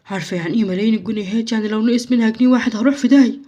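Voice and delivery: usual speaking voice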